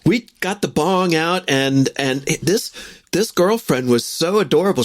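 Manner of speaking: usual speaking voice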